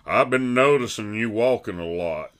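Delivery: light put-on Berman voice; a hint of Berman's voice; imitating Berman. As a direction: lightly mimic your buddy. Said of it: "here's Berman".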